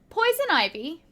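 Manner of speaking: plain reading voice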